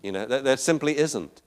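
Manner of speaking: ordinary talk, nothing marked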